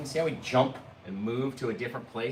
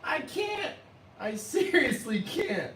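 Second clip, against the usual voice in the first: very high, squeaky words through laughter; laughing too hard to talk; slower than usual. Real, not acted. Painter to viewers, too giddy to paint, squeaking through laughter. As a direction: gasp it out between giggles. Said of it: "it's too funny".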